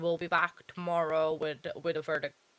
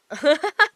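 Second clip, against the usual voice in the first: high loud burst of laughter; real loud laugh; 8 semitones higher, 12 dB louder than usual. Real, not acted. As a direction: crack up at the joke. Laughs at chat joke.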